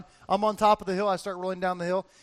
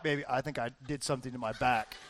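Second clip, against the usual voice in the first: much lower and softer, quick; sudden drop to a small, low admission; sheepish confession; 9 semitones lower, 9 dB quieter, faster than usual. Retold or imitated. Husband to wife after carrying her, sheepish low confession.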